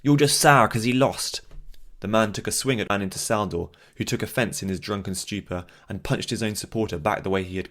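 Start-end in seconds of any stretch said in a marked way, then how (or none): 0.0-1.4 loud taunt. Acted. Bystander taunting.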